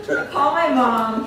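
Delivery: ordinary delivery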